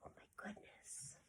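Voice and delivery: breathless hushed alarm; shocked whisper. Acted. Teacher reacting to the dragon snatching the baby, hushed.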